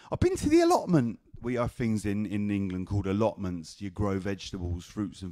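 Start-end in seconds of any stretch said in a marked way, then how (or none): 0.1-1.2 very high mock grandma voice. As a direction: announce it in grandma's high call. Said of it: "She sounded like this".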